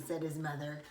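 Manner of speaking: ordinary delivery, nothing marked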